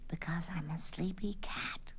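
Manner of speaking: slow, soft, breathy answer; sleepy whisper. Acted. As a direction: whisper it as a drowsy child. Child answers drowsily, keeping quiet.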